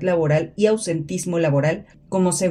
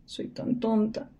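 hushed self-insult; 4 semitones higher, 8 dB quieter, slower than usual. Acted.

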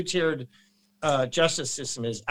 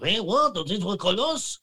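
high, puzzled mock foreigner; mock confused French; 5 semitones higher than usual. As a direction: play a baffled Frenchman, pitched up. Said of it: "The French are like".

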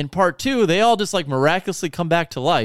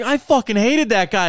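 gleeful outburst; 8 semitones higher, 3 dB louder than usual. Retold or imitated. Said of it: "I was like".

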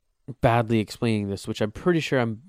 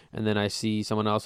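ordinary talk, nothing marked

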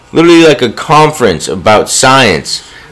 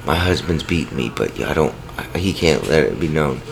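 hushed victim voice; 11 semitones lower, 13 dB quieter than usual. Retold or imitated. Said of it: "calls the counselor and says".

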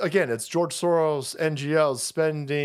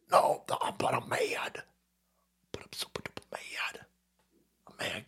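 unvoiced whisper, much softer, claiming anger; mock mad whisper; 9 semitones lower, 10 dB quieter than usual. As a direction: whisper your fury, barely audible.

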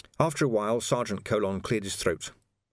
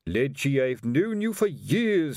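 slow, drawn-out exasperation, pitched up; weary appeal to an old acquaintance; tiredly remonstrate, stretching the words; 6 semitones higher, 3 dB louder, slower than usual. A narrator's reading. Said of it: "said Colon wearily".

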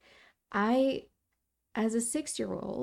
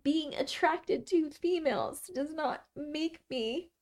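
tearful and slow; 5 semitones higher than usual. Real, not acted. Crying while speaking, far timbre.

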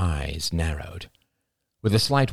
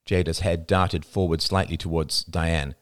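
ordinary delivery

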